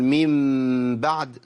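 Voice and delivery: من بعد held slowly with lips fully closed on the hidden م. Shown as a demonstration. Teacher to students, slow closed-lip demo. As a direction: press the lips shut through من بعد. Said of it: "إطباق للشفتين أقول".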